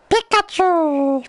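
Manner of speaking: very high, drawn-out creature cry; high-pitched Pikachu voice, stretched long. Acted. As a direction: squeal the name slowly like the Pokemon. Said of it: "The Pikachu I do".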